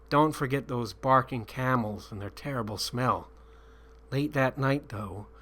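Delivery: plain reading voice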